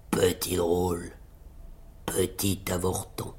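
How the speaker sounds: low, quiet giant's scorn; mépris. A narrator's reading. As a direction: look down on the little man, deep and soft. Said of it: "lui répondit d'un air de mépris". (géant méprisant, voix basse)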